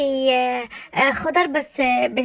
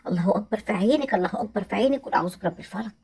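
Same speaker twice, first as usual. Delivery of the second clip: warding off evil eye; 5 semitones lower, 5 dB quieter, faster than usual. Acted.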